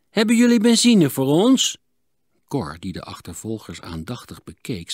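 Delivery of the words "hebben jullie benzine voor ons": hoog en luid over het water; roepende vraag. A narrator's reading. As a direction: roep de vraag naar een andere boot. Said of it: "riepen ze". (luid en hoog geroepen)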